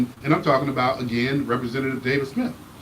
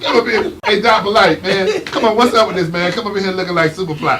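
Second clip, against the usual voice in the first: teasing call-out; 4 semitones higher, 6 dB louder than usual. Real, not acted. Teasing guest about flashy outfit.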